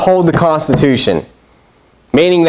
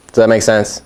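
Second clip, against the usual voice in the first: normal talking voice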